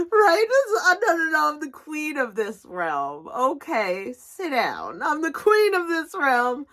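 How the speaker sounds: high, hammed-up laughing queen; light regal mock. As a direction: proclaim yourself queen, half laughing.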